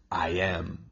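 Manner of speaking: low, hushed declaration; quiet, deep-set statement of identity; Jesus declaring himself. Acted. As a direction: drop pitch and volume, utterly composed. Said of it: "he steps up and says".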